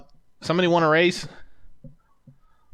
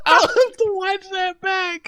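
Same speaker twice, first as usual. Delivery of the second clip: very high, slow squeal through laughter; real laughing fit; slower than usual. Real, not acted. Host to co-hosts about his own mishap, laughing helplessly. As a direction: wheeze it out high while cracking up.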